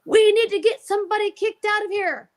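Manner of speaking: high-pitched, urgent mock alarm; urgent demand. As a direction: re-enact him pleading urgently, voice pitched right up. Frantic quote of man arriving.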